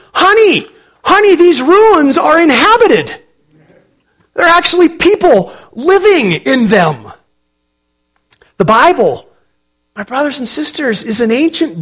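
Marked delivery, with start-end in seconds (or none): 0.1-7.1 high, loud, delighted calling out; excited cry. Retold or imitated. Wife calling to husband about inhabited ruins, excited cry. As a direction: cry out to her husband in thrilled surprise. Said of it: "she cried out excitedly".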